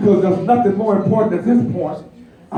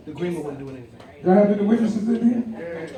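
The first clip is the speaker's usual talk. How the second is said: ordinary talk, nothing marked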